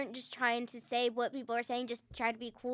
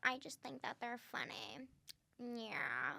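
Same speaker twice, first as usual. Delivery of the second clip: creaky Kardashian vocal fry; 9 dB quieter than usual. Pretend. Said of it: "Are you doing a vocal fry voice to impersonate them?".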